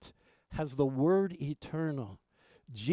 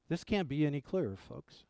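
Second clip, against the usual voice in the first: normal talking voice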